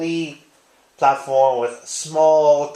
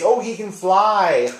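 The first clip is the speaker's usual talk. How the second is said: higher, slow, awed exclamation; childlike amazement; 6 semitones higher, slower than usual. Retold or imitated. A grown man recalling his boyhood self watching Superman, slow and amazed. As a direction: relive a small boy's wonder at first seeing him fly. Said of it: "I was just so amazed".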